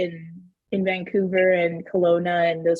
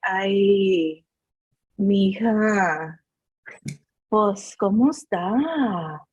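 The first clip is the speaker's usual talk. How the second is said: lightly voiced calm dad, slow on the Spanish; dad impression; much slower than usual. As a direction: drag it out slowly like her calm father. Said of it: "I always do this impersonation of him".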